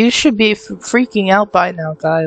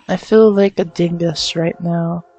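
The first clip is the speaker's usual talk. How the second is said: ordinary talk, nothing marked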